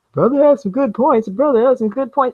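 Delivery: high, put-on agreeing voice; mock follower. Vlogger mimicking followers who fall for it, mock agreeing.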